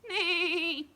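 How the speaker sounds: high loud whinny; loud horse neigh. Acted. Horse voiced.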